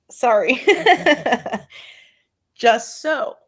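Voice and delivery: apologise, laughing at your own tears; teary sorry and a laugh; tearful laugh. Real, not acted. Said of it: "I'm getting so emotional".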